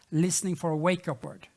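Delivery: ordinary talk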